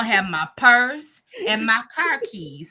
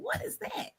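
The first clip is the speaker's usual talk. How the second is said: very soft and high; small, hushed, puzzled voice; wary bedtime question; 14 dB quieter than usual. Retold or imitated. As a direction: wonder it under your breath. Waking to a strange noise.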